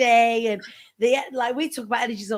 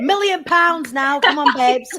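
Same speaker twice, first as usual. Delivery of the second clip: mock excited cheer; 3 semitones higher, 7 dB louder, slower than usual. Joking about manifesting money.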